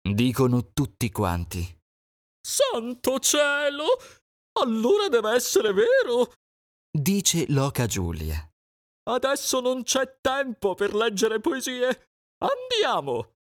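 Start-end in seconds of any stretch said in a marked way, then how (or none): 2.5-13.3 much higher, fussing goose; alarmed goose. Acted. Goose deciding to flee, alarmed higher voice.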